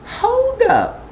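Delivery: squeaky high mock voice; mock hold up. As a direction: say it in a comic high voice. Recap, speaker reacts mockingly.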